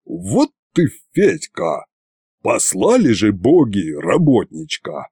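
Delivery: mild bleating reproach in a put-on voice; lightly voiced old grouch scolding; reproachful grumble. A narrator's reading. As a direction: grumble it with disapproval. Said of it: "провякал".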